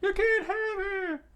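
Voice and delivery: put-on very high, loud falsetto kid yell; screaming boys. A narrator's reading. Boys rallying with weapons around the girl, screaming. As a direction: squeal it in defiance. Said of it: "and screamed out".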